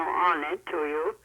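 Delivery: ordinary delivery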